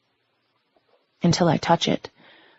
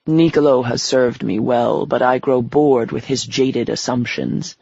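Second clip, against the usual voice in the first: a lightly lowered, menacing devil; 2 semitones lower than usual. Acted. Devil character voice.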